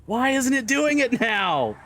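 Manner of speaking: a drawn-out high complaint; high exasperated whine. Real, not acted. Exasperated at failing trick.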